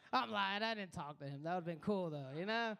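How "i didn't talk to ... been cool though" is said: ordinary delivery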